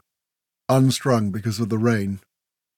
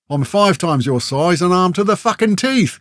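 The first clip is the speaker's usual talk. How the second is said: high, raised roar climbing to the end; roaring rage; 7 semitones higher, 4 dB louder than usual. A narrator's reading. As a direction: roar it, enraged. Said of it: "Grell roared".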